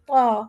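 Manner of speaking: طا thick and heavy, mouth straight, not rounded; a heavy, upright طا; thick ta sound. Shown as a demonstration. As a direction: say طا full and heavy without rounding the lips. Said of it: "بالکل ایک موٹی آواز نکلے گی، لیکن کھڑی آواز".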